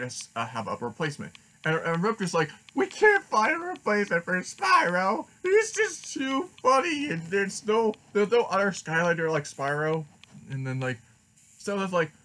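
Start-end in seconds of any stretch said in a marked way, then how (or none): 2.7-10.0 high, loud mock wail; panicked Eruptor. Eruptor protests to the team about replacing Spyro, high-pitched panicked wail. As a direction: whine it high in exaggerated despair.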